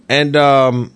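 ordinary delivery

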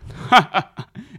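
loud booming laugh; Tom's big belly laugh; hearty laugh. A narrator's reading. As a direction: burst out laughing. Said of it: "Tom éclata de rire".

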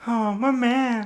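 high, bright diva speaking voice; [name] impression. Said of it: "Céline, c'est comme".

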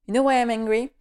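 ordinary delivery

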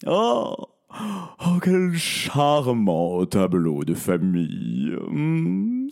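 slow, drawn-out villain's delight; Poukmambo gloating. A narrator's reading. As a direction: savour the scene with mock admiration. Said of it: "se réjouit Poukmambo". (méchant qui se réjouit, lentement)

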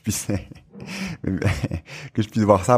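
slow words broken by real laughter; laughing. Real, not acted. Host laughing while wishing he could attend the workshop. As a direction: laugh through the wish to be there.

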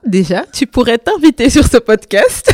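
laughing loud and high; teasing through a laugh. Real, not acted.

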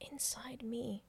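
dying whispered words. Retold or imitated. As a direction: breathe it out as a secret. Diva repeats the secret.

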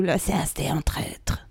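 low, whispered mock scandal; hushed verdict. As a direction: drop to a near-whisper, as if condemning him. Mock scandal about the singer. (chuchoté, faussement scandalisé)

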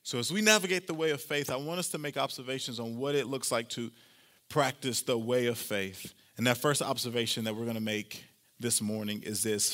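ordinary talk, nothing marked

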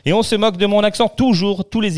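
plus aigu et plus fort, débit rapide, plainte amusée; râle en riant à moitié contre les moqueries; faussement outré. Real, not acted. Se plaint qu'on moque son accent. (protestation faussement indignée, aiguë et rapide)

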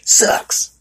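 a mock contemptuous Sucks; spat Sucks. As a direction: spit the word Sucks at him. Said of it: "She said it like that too".

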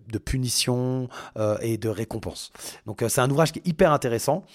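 ordinary talk, nothing marked

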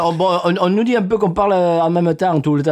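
lightly voiced southern French host; Radio Cagole host. (animateur méridional parodique, légèrement caricaturé)

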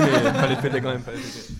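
loud laughing burst, then calmer laughing; laughing celebration. Real, not acted. Celebrating a co-host's marriage, laughing loudly at first. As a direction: cheer through laughter, then settle.

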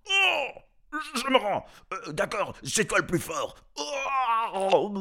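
very high, loud panicked howl; blinded giant howling. A narrator's reading. Blinded giant screaming his surrender, panicked. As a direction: wail in pain and surrender. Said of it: "hurla le monstre aveuglé".

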